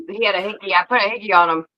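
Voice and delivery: normal talking voice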